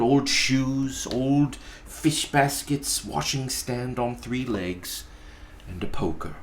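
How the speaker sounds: usual speaking voice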